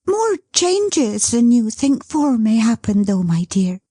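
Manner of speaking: put-on gentle, bright young wife; Dot's voice. Dot speaks to Bertha.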